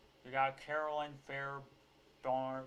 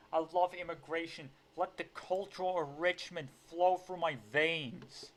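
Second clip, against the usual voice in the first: mock rapture, raised and building; sarcastic gushing; 4 semitones higher, 4 dB louder than usual. Commentator pretending to adore immigration, sarcastic rapture. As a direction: gush with fake ecstasy, building to a loud finish.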